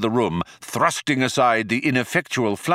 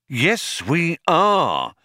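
raised, emphatic affirmation; insistent; 8 semitones higher, much slower than usual. A narrator's reading. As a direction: insist against the contradiction. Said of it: "insisted Magic Thighs".

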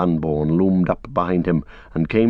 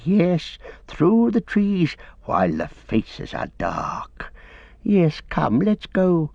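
high, slow, wheedling creature voice; eager Gollum; 7 semitones higher, slower than usual. A narrator's reading. Gollum urging Frodo to go, in a high wheedling creature voice. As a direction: coax him along like an eager creature.

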